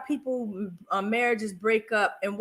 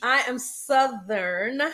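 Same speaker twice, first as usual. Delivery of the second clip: SOUTHERN stretched out slowly; slow, deliberate stress on "Southern"; emphatic correction; much slower than usual. Real, not acted. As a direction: draw out "Southern" to insist on it. Insists she is Southern, not country.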